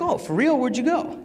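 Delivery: high mock-eager questioning; eager interest. Husband asking wife about her trip, high eager surprise.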